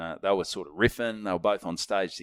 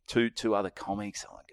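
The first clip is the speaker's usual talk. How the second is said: normal talking voice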